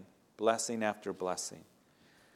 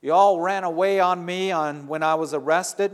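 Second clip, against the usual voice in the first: loud, high scolding shout; harsh accusing yell at runaway disciples; angry rebuke; 5 semitones higher, 5 dB louder than usual. Retold or imitated. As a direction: berate them as rebels and backsliders. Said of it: "He doesn't say".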